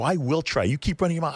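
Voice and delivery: high-pitched retold angry retort; mock yelling back. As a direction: act out firing back in an argument. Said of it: "so now I'm yelling at him".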